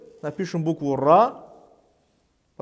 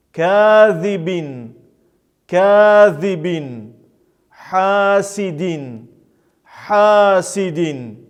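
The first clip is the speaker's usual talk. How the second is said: measured, drawn-out word with a falling end; Arabic reading drill; 6 dB louder, much slower than usual. Shown as a demonstration. Teacher models tanween examples for learners, careful drawn-out recitation. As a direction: recite each word singly, stretching the long vowels.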